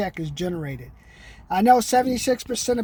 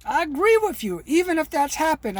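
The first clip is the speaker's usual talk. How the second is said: higher, eager salesman, agreeing with everything; agreeable sales voice; 6 semitones higher than usual. Pretend. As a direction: keep up the friendly pitch-man voice. Role-plays agreeing with a customer.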